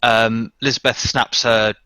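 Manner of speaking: ordinary talk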